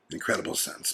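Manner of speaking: whispered. Real, not acted. Whispered echo of own phrase.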